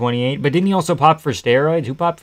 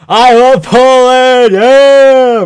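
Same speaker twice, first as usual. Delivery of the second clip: full-throated cheering scream, much higher and louder; real scream; 12 semitones higher, 15 dB louder, much slower than usual. Real, not acted. Streamer answers his own call to scream for Poland, a real loud scream. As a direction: belt it out like a rowdy fan. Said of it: "Scream if you love Poland".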